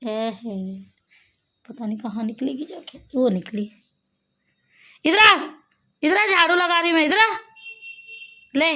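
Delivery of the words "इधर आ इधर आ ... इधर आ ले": loud, high call to come here. Real, not acted. Woman sweeping calls the cat loudly to come here. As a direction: call the cat over loudly across the yard.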